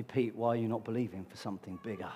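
Jesus asks the preacher Pete about his faith, softly; imagined Jesus. Retold or imitated. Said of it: "He said to me".

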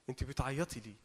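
low, soft consoling question; calming, tender. Acted. Jesus calming Mary as she cries, low and gentle. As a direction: quieten her gently. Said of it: "هو جاي بيهديها".

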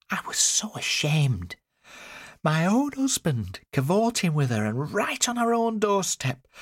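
high, slow, scandalised voice; high-pitched wronged wife; ashamed, outraged mother. A narrator's reading. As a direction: relive the shame, drawn out and high. Mother recounts shame and outrage.

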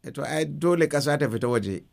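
normal talking voice

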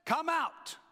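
high, projected calling out; loud cry. A narrator's reading. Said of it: "cried out in a loud voice".

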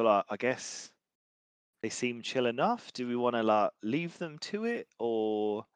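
normal talking voice

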